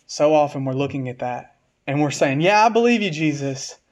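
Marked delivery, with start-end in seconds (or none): 2.4-3.8 high, louder mock-eager affirmation; mock profession of faith. Preacher mimicking believers who say they trust but still complain, mock-eager. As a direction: pitch it up like an overeager believer, then drop.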